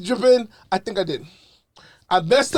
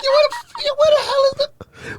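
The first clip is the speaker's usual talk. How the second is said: incredulous yell. Retold or imitated. Retelling watching Baywatch, high laughing mock shout. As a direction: cry out in mock disbelief.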